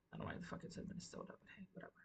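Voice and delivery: a rushed whisper; fast whispered aside. Real, not acted. Host grumbling about the Minnesota caption, fast whisper. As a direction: whisper it quickly.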